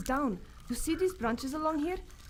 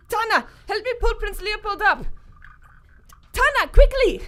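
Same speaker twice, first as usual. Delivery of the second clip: louder and louder, high and urgent; calling for help; 7 semitones higher, 8 dB louder than usual. Acted. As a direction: Manjula calling Tana for help, rising to a loud urgent shout. Calling for help holding him.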